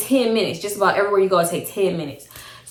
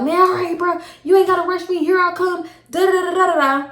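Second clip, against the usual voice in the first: pitched way up, mocking his rush; high, quick, mocking the impatient boyfriend; 9 semitones higher than usual. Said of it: "I don't feel like".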